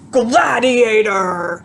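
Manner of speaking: drawn-out, loud battle roar; booming battle cry. Retold or imitated. Seminar guru shouting his power word, booming battle cry. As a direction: roar it slowly like a warrior. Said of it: "his word that he uses is".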